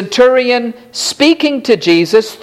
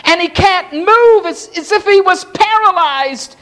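pleading father; 6 semitones higher than usual. Acted. Centurion pleads for his boy.

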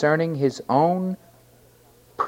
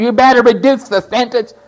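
loud, high, forceful demand; 9 semitones higher, 9 dB louder than usual. Acted. Defendant ordering the judge to cut the sentence, demanding. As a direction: push it out like an order to the bench. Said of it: "You didn't go up demanding".

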